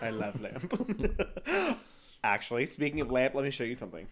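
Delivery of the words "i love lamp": drawn-out movie quote. Retold or imitated. Quoting Anchorman line.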